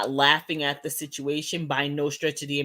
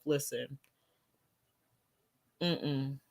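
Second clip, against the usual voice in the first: ordinary talk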